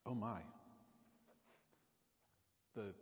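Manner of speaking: ordinary talk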